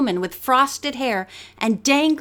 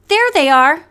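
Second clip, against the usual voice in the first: louder, pointing it out; announcing; 4 semitones higher, 7 dB louder than usual. A narrator's reading. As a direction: call out that you've spotted them. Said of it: "Nancy announced".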